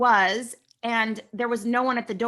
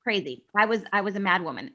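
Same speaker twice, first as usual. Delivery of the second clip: normal talking voice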